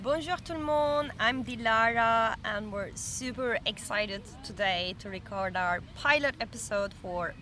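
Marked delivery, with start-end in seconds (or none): none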